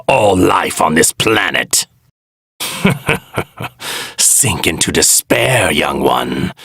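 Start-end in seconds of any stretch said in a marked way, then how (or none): 2.6-4.1 soft dark chuckle under the breath; a villain's hushed chuckle; quiet chuckle. Acted. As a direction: laugh quietly to yourself. Villain gloating over victim.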